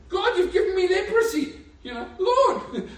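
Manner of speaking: high, loud panicked cry with a laugh. Acted. Moses pulling out his leprous hand, crying out panicked to God.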